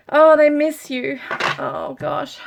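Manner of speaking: moved, loud and high then falling; overwhelmed with emotion. Real, not acted. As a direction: burst of feeling, then drop away. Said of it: "the emotion comes through".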